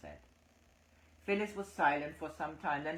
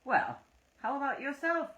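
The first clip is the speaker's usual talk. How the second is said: louder, much higher retort; louder high retaliation; retaliating; 14 semitones higher, 8 dB louder than usual. A narrator's reading. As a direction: throw it back at him loudly. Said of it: "Andreja retaliated".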